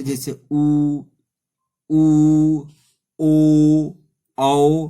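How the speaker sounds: उ, ऊ, ओ, औ called out loud with rounded lips; rounded vowels shown. Shown as a demonstration. Teacher showing rounded vowels, loud lip-rounded उ, ऊ, ओ, औ. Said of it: "इसमें मुंह वृत्त के आकार का खुले".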